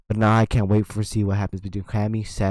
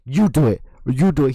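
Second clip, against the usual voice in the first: louder, pushing command; a louder push handing over the chair; insistent urging; 2 semitones higher, 5 dB louder than usual. Retold or imitated. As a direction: shove the chair at him with your voice. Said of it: "he was like".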